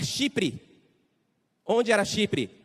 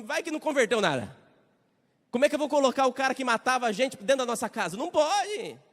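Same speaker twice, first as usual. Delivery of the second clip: anxious objection; 7 semitones higher, 4 dB louder than usual. Acted. Frightened Christians object.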